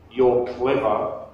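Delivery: normal talking voice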